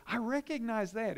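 light mock of her excitement; delighted recognition. Daughter quoted recognizing something.

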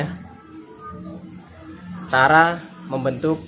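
ordinary talk

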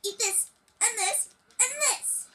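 high strained yelps; battle cries. Pretend. Kid yelping through the game fight, high and strained. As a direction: cry out with each strike.

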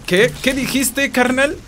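high-pitched, mildly hammed-up challenge; mock affronted. Challenging a pal over an insult, mock affronted.

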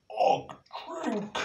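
high, gruff caveman cry; excited caveman. Acted. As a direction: Og wants to drink. Og at fountain.

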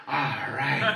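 low, soft reluctant agreement; mock resignation. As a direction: give in quietly, pitched well down. Retold reluctant acceptance.

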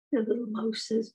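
ordinary talk, nothing marked

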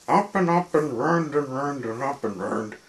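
climbing narration. A narrator's reading. Narrator follows them up the stairs, high loud winding voice. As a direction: lift the voice for the climb.

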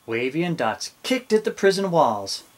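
plain reading voice

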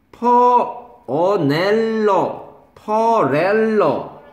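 "퍼", "어넬러", "퍼렐러" high, loud and drawn out; loud, exaggerated "어-NEL-러, 퍼-REL-러"; loud corrective drill. Shown as a demonstration. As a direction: hammer each syllable of "퍼렐러" for a slow learner. Teacher drilling a student's missed sound.

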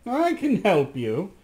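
slow and sly; crafty. Acted. The crafty magician slyly offers Rasmus help. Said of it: "said the crafty Dappertutto".

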